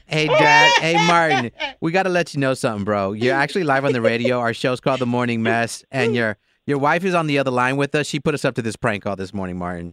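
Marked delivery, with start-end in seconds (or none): none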